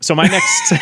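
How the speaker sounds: breaking up with laughter; can't get through it for laughing. Real, not acted. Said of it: "that was a struggle for you".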